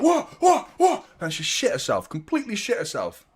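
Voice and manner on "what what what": much higher startled "what"; startled wife. Retold or imitated. Wife woken in the night, startled high cries. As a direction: jolt awake alarmed. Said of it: "my missus was like".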